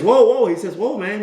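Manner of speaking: high-pitched startled protest; alarmed protest. Acted. Timmy stopping the boss who passed him over, alarmed. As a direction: burst in high to stop him.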